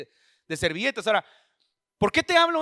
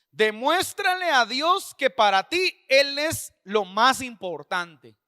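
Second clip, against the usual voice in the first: emphatic scripture reading; 4 dB louder, much slower than usual. Acted. Preacher reads the verse to the congregation, slow emphatic reading. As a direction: draw out each phrase of the verse.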